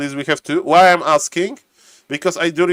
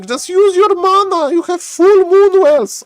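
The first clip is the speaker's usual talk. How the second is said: high-pitched, raised-voice plea; exasperated urging; 13 semitones higher, 5 dB louder than usual. Real, not acted. Caster to player about unused mana, high exasperated cry.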